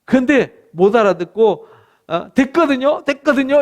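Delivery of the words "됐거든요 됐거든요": high, loud, quick cheeky retort; mock defiant brush-off. Stubborn people rebuff God's advice, cheekily defiant. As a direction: wave off advice like a stubborn child, hammed-up. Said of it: "이러고 이제 배 내밀고".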